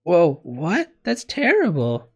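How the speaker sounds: loud, high, drawn-out dismay; shocked on the listener's behalf; appalled surprise. A narrator's reading. Kitty reacts to the sad story.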